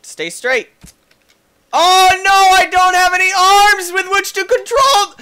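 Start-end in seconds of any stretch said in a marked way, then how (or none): 1.7-5.2 high loud laughing wail; laughing panic. Real, not acted. Character losing arms.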